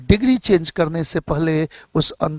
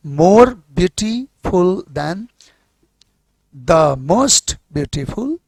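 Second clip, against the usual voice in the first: more beautiful said very slowly and deliberately; slow drilled forms; much slower than usual. Shown as a demonstration. Teacher drills the class on more and most, very slow. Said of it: "तीन टुकड़े में तोड़े हैं तो बना".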